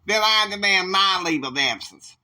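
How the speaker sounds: loud, high, insistent outburst; indignant counter-demand; angry demand. Acted.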